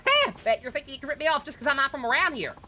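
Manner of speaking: high, sharp-tongued Southern woman; a bossy lady calling the driver a dolt; sassy Southern lady passenger. Acted. Passenger scolding the taxi driver, high Southern lady voice.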